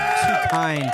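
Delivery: high, hammy mock modesty; mock flattered. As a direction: gush with fake humility. Hammed-up flattered reply.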